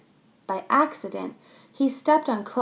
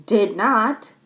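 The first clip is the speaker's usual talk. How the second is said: sharp louder did not; louder denial; 7 dB louder than usual. Acted. Max snaps back at Chloe, louder. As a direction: snap it back. Kids bicker.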